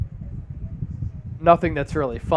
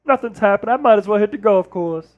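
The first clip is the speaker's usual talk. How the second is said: Barkley impression. Keeps Barkley voice on chat comments.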